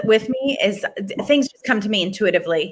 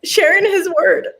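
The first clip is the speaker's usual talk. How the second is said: real tears, voice pitched high and slow; fighting back tears, pushing the words out slowly; choked up, breaking into high, slow words; crying; 9 semitones higher, 4 dB louder, slower than usual. Real, not acted. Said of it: "I'm gonna cry".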